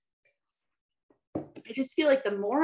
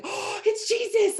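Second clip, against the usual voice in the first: much higher, breathless gasp of wonder; awed recognition. Retold or imitated. Recalling her first sight of Jesus on screen, awed high gasp. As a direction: squeal it like you just saw him. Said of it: "I was like".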